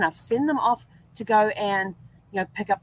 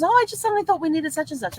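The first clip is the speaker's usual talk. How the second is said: higher and fast, offhand husband; husband's breezy excuse; 11 semitones higher, faster than usual. Retold or imitated. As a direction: toss it off quickly like a casual husband. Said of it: "And he goes".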